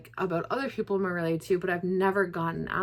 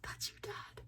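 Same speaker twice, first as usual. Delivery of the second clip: hushed whisper, much softer; 17 dB quieter than usual. Retold or imitated. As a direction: breathe it like a secret. Mom answers in a hush.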